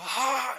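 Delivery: high-pitched gasping mock scream; imitated scream. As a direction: imitate a sudden cry of pain. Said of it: "Empezó a gritar".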